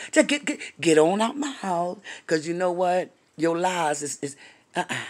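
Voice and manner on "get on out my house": ordinary delivery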